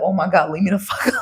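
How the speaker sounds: fast, laughing, half-shouted; laughing mock exasperation. Mock-annoyed at people blowing up her phone, laughing. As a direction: blurt it out fast through a laugh. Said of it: "And you're like".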